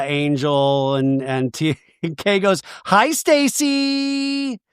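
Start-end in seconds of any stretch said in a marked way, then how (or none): 2.9-4.6 high, long drawn-out mock greeting; greeting with attitude. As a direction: stretch the name out with exaggerated attitude. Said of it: "A little attitude is thrown".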